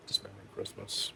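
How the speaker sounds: title breathed out in a whisper; hushed creepy whisper of "Dismembering Christmas". Real, not acted. Title repeated as an aside.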